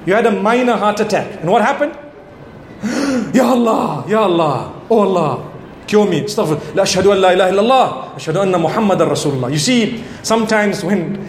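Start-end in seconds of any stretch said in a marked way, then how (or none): 2.8-3.3 a short startled gasp of shock; sharp gasp. Acted. Sudden heart attack scene. 3.3-9.5 lower, hushed pleading to God; a scared patient begging for cure; frightened prayer. Acted. Patient praying after heart attack.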